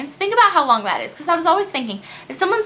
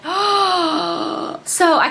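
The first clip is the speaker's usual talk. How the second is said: sharp gasp, then a high, hushed oh; dismayed gasp; 5 semitones higher, much slower than usual. Retold or imitated. Recounting seeing the dent in her car, a gasping dismayed oh. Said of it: "and I'm like".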